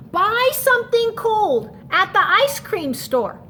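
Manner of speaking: high, loud urgent yell; children yelling. Acted. Children telling the snowman what to do, high loud yell. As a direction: shout it like eager kids. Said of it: "The children yelled".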